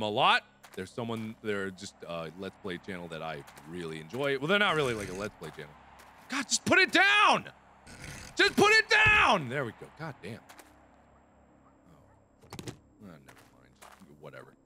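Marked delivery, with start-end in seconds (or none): 6.3-9.5 exasperated yell. Real, not acted. Yelling at the game.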